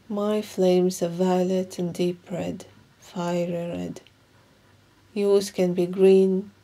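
slow, measured meditation guide with long pauses; guided meditation. Acted. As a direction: guide them calmly through each breath, leaving room between phrases.

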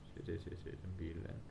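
counting frets under his breath in a whisper. Real, not acted. Repairer counting frets to himself, whispered. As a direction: mutter the count to yourself.

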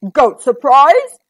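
troll perks up at the idea; troll surprised. A narrator's reading. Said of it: "said the Troll".

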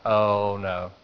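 low, soft sinking realization; retold dread. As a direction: let the voice drop away in dismay. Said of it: "Now I'm freaking out".

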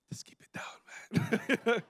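slow, whispered plea; acted-out furtive whisper; embarrassed hush. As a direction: shush him under your breath. Hushing swearing partner, acted.

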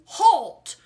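huge, high, shouted halt; deafening bellow. Acted. The highway rat bellowing at the ants, deafening. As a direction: bellow it to stop everyone dead. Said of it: "bellowed a deafening".

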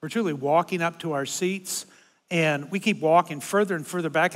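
usual speaking voice

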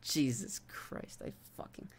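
slow, soft whispered exasperation; exasperated under breath. Real, not acted. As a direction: breathe it out, fed up.

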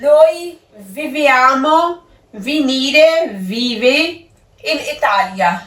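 very slow Italian words; slow sentence reading. Shown as a demonstration. Teacher slowly reads the fourth exercise sentence. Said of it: "ਚੌਥਾ sentence ਹੈਗਾ ਹੈ".

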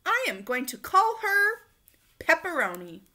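proud loud announcement; boy naming his cat; announcing. A narrator's reading. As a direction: proclaim the name. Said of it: "Tony announced".

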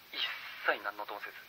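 ordinary delivery, nothing marked

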